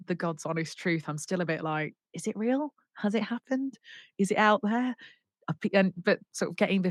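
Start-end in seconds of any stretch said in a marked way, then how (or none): 2.1-4.9 high, rising string of questions; wondering disbelief. Retold or imitated. Singer asking herself about her success, wondering disbelief. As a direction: test each question as if hardly daring to believe.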